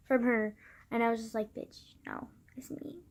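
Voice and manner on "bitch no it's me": very soft retold confession; hushed, under-the-breath quote; sheepish admission. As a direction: drop to a near-whisper to own up.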